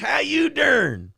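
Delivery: very high, slow drawn-out yell; Bud Britches catchphrase. Retold or imitated.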